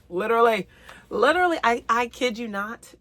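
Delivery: loud, rising rush of disbelief; can't believe the timing, loud and eager; excited. Real, not acted. Reader tells viewers the card fits perfectly, loud excitement.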